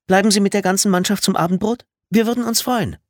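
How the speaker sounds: high-pitched, eager invitation; eager hostess. A narrator's reading. Mother invites the whole team to supper, eager hostess.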